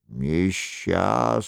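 low, slow, solemn reproach; a solemn accuser from the dark, drawn out; stern mysterious voice. Acted.

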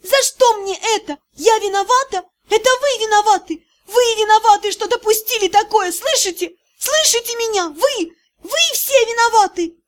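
high screaming accusation; girl screams blame at the prince. A narrator's reading. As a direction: scream it in his face. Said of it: "закричала она в лицо Киру".

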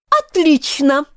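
high-pitched imperious cry; yelling queen. A narrator's reading. Queen pleased with her soldiers, high yell. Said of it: "завопила королева".

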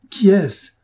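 near-shouted plea; frantic shout. A narrator's reading. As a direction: burst out demanding the name. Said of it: "avec une agitation extrême".

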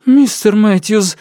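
high, sobbing shout for guards; panicked shout. A narrator's reading. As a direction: scream it, near tears, drawn out. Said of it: "выкрикнул он и сполз по стене, содрогаясь от рыданий".

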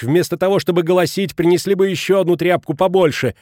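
high, loud scolding; shouted order. A narrator's reading. Mrs Schmidt ordering the wailing woman to fetch a rag, shouting. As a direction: call out sharply over the wailing. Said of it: "Затем крикнула причитающей госпоже Халич".

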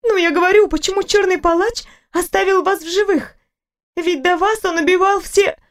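a fussing nurse who cannot stop talking; chatty nurse. Acted.

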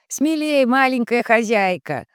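high, echoing voice under stone vaults; booming echo. Acted. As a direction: call out as if from a vaulted hall. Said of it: "раздался под сводами гулкий голос Рома". (гулкий голос под сводами)

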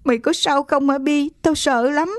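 put-on high, whimpering little girl; frightened, whiny concern; tearful worry. A narrator's reading. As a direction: plead fearfully, on the edge of tears. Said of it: "Con Hương méo máu".

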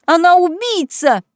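high, loud, slow yell; wailing yell. Acted. Beatrice calling Kaitlyn a murderer, loud slow yell. Sister keeps yelling.

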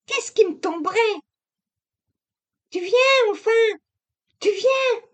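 put-on high young woman brushing it off; the wife cutting the talk short; young wife, impatient. A narrator's reading. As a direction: hurry him out the door. Narrator voices Camille.

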